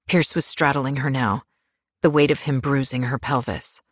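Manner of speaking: ordinary delivery, nothing marked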